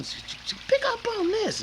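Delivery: very high chopped sample voice; stuttered sample. Retold or imitated. Building the track, high sample mimic. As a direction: stutter it like a scratched record.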